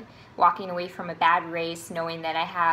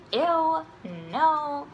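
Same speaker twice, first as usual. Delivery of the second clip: higher, disgusted ew, no; grossed-out; 7 semitones higher than usual. Retold or imitated. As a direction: recoil at a gross comment. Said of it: "I'm like".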